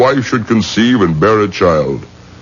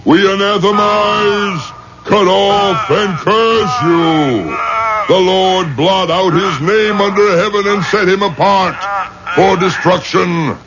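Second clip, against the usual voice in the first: higher, booming curse, slowed on the last words; shouted excommunication; 5 semitones higher than usual. Acted. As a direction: pronounce the anathema like divine judgment. Rabbi pronounces excommunication.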